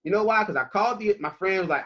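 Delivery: usual speaking voice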